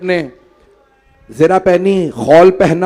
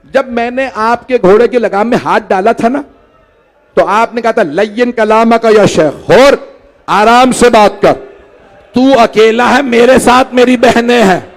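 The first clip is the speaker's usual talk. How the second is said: much higher and louder, building to a shout; impassioned shout; 7 semitones higher, 6 dB louder than usual. Acted. Hur begging forgiveness before the Imam's sisters, shouted. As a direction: cry it out, climbing to a full shout.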